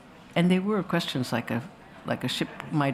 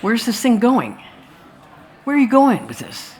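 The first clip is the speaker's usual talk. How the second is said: higher and louder, impatient demand; exasperated editor; 7 semitones higher, 7 dB louder than usual. Retold or imitated. The editor pushing Melville about his plot, exasperated and louder. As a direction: press him like a fed-up editor.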